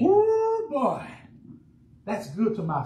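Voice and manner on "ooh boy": a squeal of pleasure at the answer; delighted ooh. Real, not acted. Preacher to congregation after they answer, delighted ooh.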